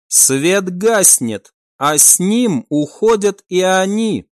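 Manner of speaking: plain reading voice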